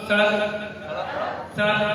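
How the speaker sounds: सड़क with s from tongue on the teeth; dental s. Shown as a demonstration. Teacher models सड़क with a dental s. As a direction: start सड़क with a crisp dental s. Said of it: "जीभ दांत से टकराती है".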